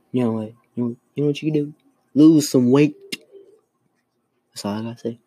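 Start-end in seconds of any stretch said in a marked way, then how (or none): 2.1-3.0 louder blunt jab; punchline to the woman, said louder. Retold or imitated. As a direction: snap it out louder.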